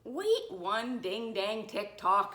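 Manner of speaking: slow, loud, emphatic halt; shouted. A narrator's reading. Nurse stops the principal phoning the superintendent, shouting. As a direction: call it out, drawing out each word. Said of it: "shouted the nurse".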